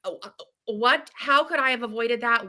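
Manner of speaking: retold distress.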